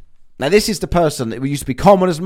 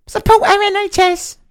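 much higher, silly put-on voice; mock slogan voice. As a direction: parody the slogan in a squeaky voice.

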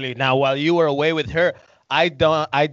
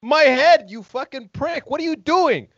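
high, shrieking cry of pain; pained scream; 12 semitones higher, 4 dB louder than usual. Acted. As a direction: scream like your head just popped. Head pops, victim screams.

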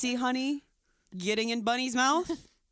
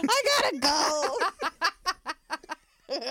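shouted exit line; high shout; 7 semitones higher, 4 dB louder, much faster than usual. Acted. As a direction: yell it high and loud.

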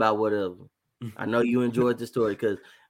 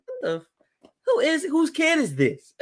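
high, laughing outraged grandma; shocked grandma. Retold or imitated. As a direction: squeal it like a baffled grandma. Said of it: "she was like".

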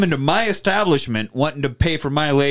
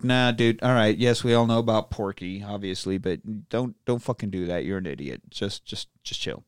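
much softer, low settle-down voice; hint of a weary sheriff brother; mock calming; 5 semitones lower, 6 dB quieter than usual. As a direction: talk him down quietly.

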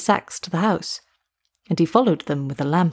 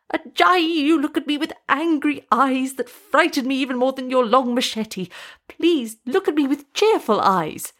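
high, fearful plea; crying out in fear; 6 semitones higher than usual. A narrator's reading. Witch doctor begging Ajayi not to glare at him, high fearful plea. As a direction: beg with eyes shut tight. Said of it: "cried out in fear".

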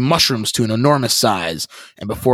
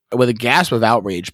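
ordinary talk

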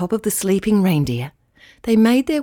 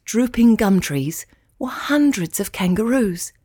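ordinary delivery

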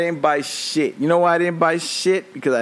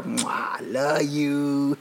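tender kiss; 3 dB quieter, much slower than usual. Real, not acted. Kiss to his wife.

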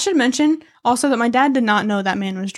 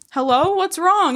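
driver calling; 7 semitones higher, slower than usual. Retold or imitated. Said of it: "So he was like".

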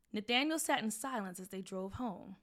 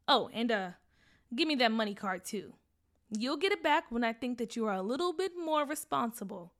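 firm mom; 3 semitones higher, 7 dB louder than usual. Acted. Mom grounds son.

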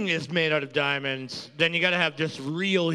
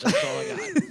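squeaky voice; faster than usual. Pretend. Squeaky joke voice.

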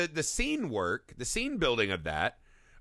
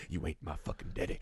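quiet, lower imitating a defiant boy; half-whispered mock child talking back; mock sulky kid; 10 semitones lower, 11 dB quieter than usual. Host replaying the scene line for his cohost, low and quiet. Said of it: "Baby Billy from, right".

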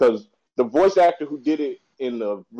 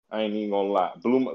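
normal talking voice

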